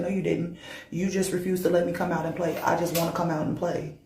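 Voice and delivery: soft, low eerie complaint; chilling mirror being. Acted. Mirror being asking to come out and play, chillingly soft. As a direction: keep the creepy plea hushed and low. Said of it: "something about the way that she said it".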